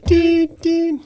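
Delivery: very high, loud ding; high bleep sound. Shown as a demonstration. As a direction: imitate a censor bleep. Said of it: "just like".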